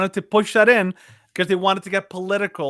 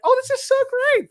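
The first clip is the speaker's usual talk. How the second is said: mock gushing grandma; faster than usual. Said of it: "would be like".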